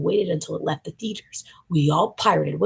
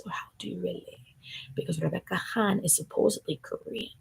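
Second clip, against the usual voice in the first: hushed conspiratorial aside; whisper; 6 dB quieter than usual. Real, not acted. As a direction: lean in and whisper the question.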